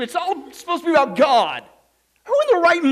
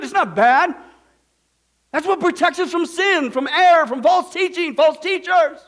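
louder, raised preaching voice; forceful preaching; 5 semitones higher, 5 dB louder than usual. Real, not acted. Preacher stressing God's word.

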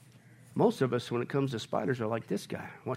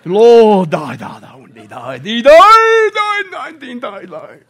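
mock British prayer; 4 semitones higher, 11 dB louder, much slower than usual. Pastor mocking a spiritual deacon's British prayer voice. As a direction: overdo a holy British deacon praying. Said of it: "they become British".

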